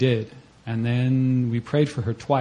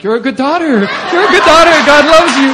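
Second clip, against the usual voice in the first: much higher and louder, bursting with confidence; encouraged blessing; 14 dB louder than usual. Retold or imitated. Teller blessing the girl again, now encouraged, much higher and louder. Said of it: "I'm encouraged".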